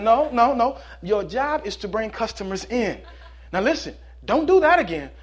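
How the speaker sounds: scolding. Acted. Owner scolds bird.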